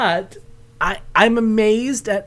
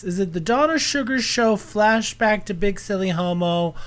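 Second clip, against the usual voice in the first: normal talking voice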